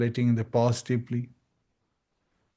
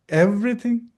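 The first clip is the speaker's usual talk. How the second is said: high, gently probing question; questioning guru; 9 semitones higher than usual. Retold or imitated. Guru gently questioning a disciple, high probing ask. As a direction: ask it kindly but doubting.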